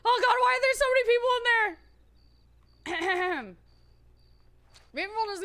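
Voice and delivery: high, loud nervous squeal; real panic. Real, not acted. Streamer panicking at a crowded town, loud and high. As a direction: yelp at the crowd in real alarm. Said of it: "I'm really scared".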